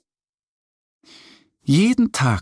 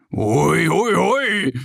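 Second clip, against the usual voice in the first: joyful whoops, rising higher; whooping; 6 semitones higher, much slower than usual. A narrator's reading. Mo riding with Annie, excited whoops. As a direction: bear having fun on the horse. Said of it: "ruft Mo".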